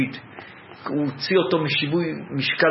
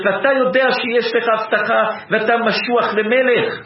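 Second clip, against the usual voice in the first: preaching with rising fervor, a bit higher and louder; 4 semitones higher, 4 dB louder than usual. Acted. Preacher to congregation about David, fervent preaching. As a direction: build the sermon's passion about the anointed king.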